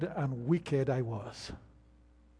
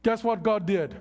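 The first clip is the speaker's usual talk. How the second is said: loud, higher, surging sermon climax; full-force preaching; 5 semitones higher, 4 dB louder than usual. Acted. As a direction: hammer it home to the church.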